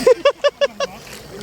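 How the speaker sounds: high-pitched burst of laughing; excited laughter. Real, not acted. Friends reacting to the catch, high laughing. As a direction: laugh giddily at the haul.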